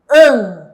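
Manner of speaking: "un." ending in a nasal ng, not n, felt in the throat; "un." como ng coreano, vibrando en la garganta; Korean ng sound. Shown as a demonstration. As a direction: ring out "un." with the Korean final ng. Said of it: "esta sílaba nosotros vamos a estar leyendo".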